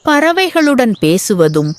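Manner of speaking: ordinary delivery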